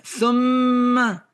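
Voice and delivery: THUMMA with a long, high, held M; "thumma" stretched high on a humming M before the final A; drawn-out shadda. Shown as a demonstration. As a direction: hold the doubled M in "thumma" to show a delay. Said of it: "You can hear in the shadda".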